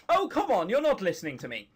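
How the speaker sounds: ordinary delivery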